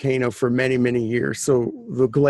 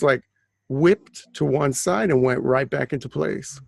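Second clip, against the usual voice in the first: ordinary delivery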